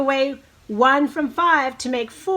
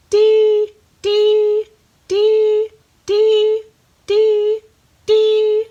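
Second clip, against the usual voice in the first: high, loud repeated birdy "dee"; bright bird-call imitation; chickadee call; 10 semitones higher, 8 dB louder, much slower than usual. Shown as a demonstration. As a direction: chirp it like a little chickadee. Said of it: "So let's do that six times".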